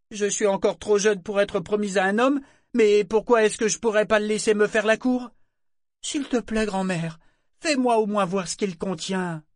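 put-on high, eager girl's voice begging; pleading teen girl. A narrator's reading. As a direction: plead with grandmother, wheedling.

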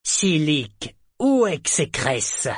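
enraged serpent demanding; furious demand. A narrator's reading. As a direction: insist angrily on an answer. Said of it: "L'air furieux".

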